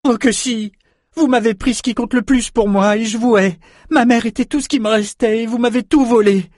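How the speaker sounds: put-on high, loud young girl in a rage; furious outburst. A narrator's reading. Orphaned girl tells Richard she hates him, high furious outburst. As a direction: pour out hatred at the top of the voice. Enraged girl screams her hatred.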